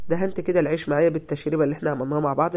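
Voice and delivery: ordinary delivery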